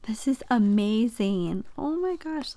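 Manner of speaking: normal talking voice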